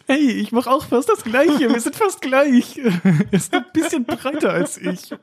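high, slow, whiny voice mocking himself; mock pleading self. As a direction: plead pathetically for attention, hammed up. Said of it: "Und ich war so". (hohe, langsame, weinerliche Stimme, sich selbst parodierend)

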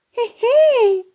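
squeaky delighted giggle; high giggle. Acted. Giggles at found treasure.

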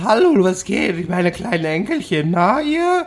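very high, squeaky mock voice break; hammed-up cracking falsetto; cracking teenage-boy voice. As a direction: greet the grandkids in a squawking puberty voice. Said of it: "mit sechsundsechzig dann immer noch so".

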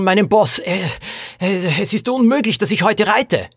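high, hurried, agitated account; panicked little jockey. A narrator's reading. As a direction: pour out your mishap in distress. (aufgeregt jammernder Jockey, hohe Stimme)

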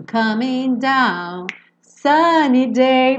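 COMING DOWN SUNNY DAY esticado, sem ON A; como se escuta. Shown as a demonstration. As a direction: cantarole devagar, omitindo ON A. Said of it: "Você escuta assim". (SUNNY DAY colado em DOWN, bem lento)

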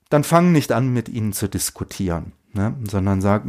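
usual speaking voice

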